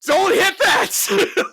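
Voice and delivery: slow, high, loud yell of alarm; panicked shout. Retold or imitated. Retold panic on the bridge.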